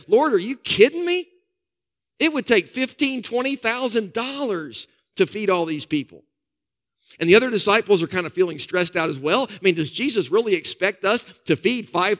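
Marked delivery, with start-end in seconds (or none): 0.1-6.2 disbelief. Retold or imitated. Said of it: "he says".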